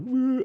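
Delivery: high-pitched mock crying wail; imitated wail. Storyteller imitating people crying at the airport, mock wail. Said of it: "when I say crying, I'm like".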